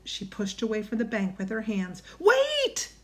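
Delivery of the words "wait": very high, loud yell; alarmed cry. A narrator's reading. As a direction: cry out to stop her. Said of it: "cried Jack".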